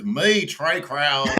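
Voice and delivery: put-on a quick, half-laughed stab at Trey Crowder's Southern accent; failed attempt at Trey Crowder's accent. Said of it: "Trey's ac-, accent is so intense".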